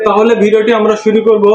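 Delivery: normal talking voice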